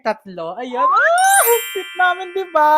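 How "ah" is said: high, loud, thrilled squeal; delighted squeal. Real, not acted. Squeals over a photo. (matinis na tili sa tuwa)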